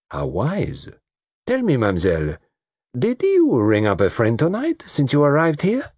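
put-on lightly accented, gently probing; courtly foreign detective. Detective slipping a question to Lola, courteous probing.

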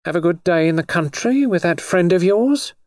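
high-pitched, frosty inquiry; cold greeting. A narrator's reading. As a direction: ask pointedly, as a displeased sweetheart. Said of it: "greeted him coldly".

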